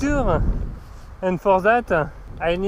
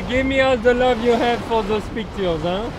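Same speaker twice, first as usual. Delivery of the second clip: ordinary talk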